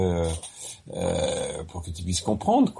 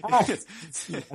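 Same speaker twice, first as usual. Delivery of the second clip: amused repeat; 12 semitones higher than usual. Retold or imitated. Host repeats the funny mistake.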